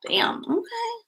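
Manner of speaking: barely voiced, a high whisper of surprise; startled whisper, half to yourself; whispered damn. Real, not acted. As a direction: breathe it out, taken aback. Reacting to spirit's bluntness.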